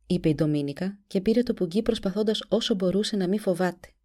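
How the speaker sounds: usual speaking voice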